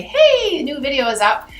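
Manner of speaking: bright high announcing voice, lightly done; YouTube notification voice. Retold or imitated. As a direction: chirp it like an app alert. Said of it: "YouTube will say".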